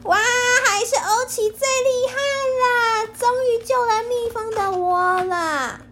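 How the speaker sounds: high, loud happy cheer. Acted. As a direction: cheer for the hero. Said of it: "大家欢呼起来".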